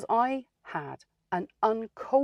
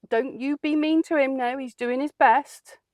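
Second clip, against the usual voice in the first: higher, louder call across the shop; 7 semitones higher, 4 dB louder than usual. Retold or imitated. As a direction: shout over to the staff, slowing at the end. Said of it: "I shouted over".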